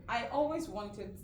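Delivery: ordinary delivery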